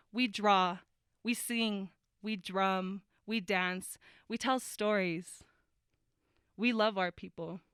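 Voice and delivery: spoken word poem. Acted.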